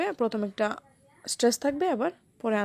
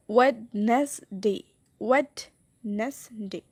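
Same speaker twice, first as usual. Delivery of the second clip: Wednesday slowly by syllable, ওয়েড weighted; strong ওয়েড, lighter নেস and ডে; stress on first syllable; much slower than usual. Shown as a demonstration. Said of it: "wait বেশি stress".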